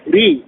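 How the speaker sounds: ري with a thin, light R. Shown as a demonstration. Teacher drills kasra for students, light R in ري. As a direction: keep the R of ري light. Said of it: "ترقيق".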